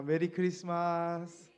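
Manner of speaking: loud, slow Merry Christmas; festive greeting. Shown as a demonstration. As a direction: call it out cheerfully to the congregation. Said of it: "한 번 더 인사하겠습니다".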